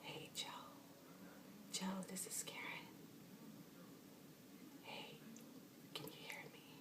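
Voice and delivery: soft breathy whisper calling a lost friend; hushed whisper to a departed friend. Shown as a demonstration. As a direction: whisper as if he might hear. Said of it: "I would whisper".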